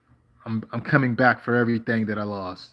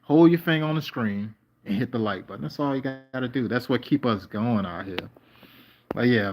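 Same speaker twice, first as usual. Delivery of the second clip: ordinary delivery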